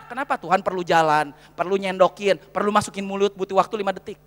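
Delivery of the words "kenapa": usual speaking voice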